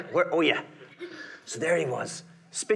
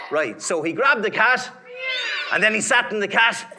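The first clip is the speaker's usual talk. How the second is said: louder, laughing retell; 8 semitones higher, 6 dB louder than usual. Real, not acted. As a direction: laugh your way through the line.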